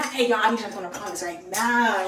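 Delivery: loud, low put-on order. Shown as a demonstration. Friend showing another take to followers, low loud demand.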